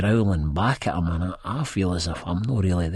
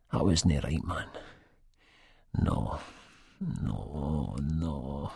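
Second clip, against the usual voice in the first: much softer, stunned; quiet and shaken; 5 semitones lower, 8 dB quieter, much slower than usual. A narrator's reading. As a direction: murmur it, shaken and hollow.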